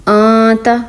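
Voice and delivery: آتا with a hidden nasal, tongue not touching the palate; ikhfa. Shown as a demonstration. Teacher demonstrating ikhfa to students. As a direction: keep the tongue in the air on آتا. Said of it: "یہاں پہ ہم اخفا کریں گے".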